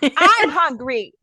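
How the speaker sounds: loud, high, drawn-out mock yell; irritated outburst. Hungry wife ignored by husband, irritated mock yell. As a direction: stretch it out in loud hammed-up frustration. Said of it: "I was so irritated".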